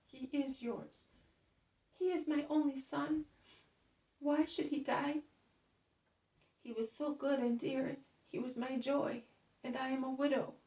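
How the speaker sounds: grieving lament. Acted. Mother to Jesus over the bier, wailing grief. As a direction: pour out a widow's anguish.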